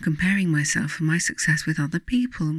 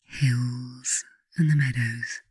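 guided meditation. Acted. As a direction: ease the listener gently toward sleep. Leads a bedtime guided meditation.

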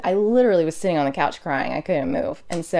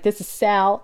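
put-on thick New York accent; 3 semitones higher, slower than usual. Sal answering his laundry business phone, thick New York accent. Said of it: "with this thick New York accent".

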